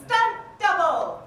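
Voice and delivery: loud drawn-out call. Acted. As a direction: shout it out to summon help. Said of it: "I have the freedom to call stunt double".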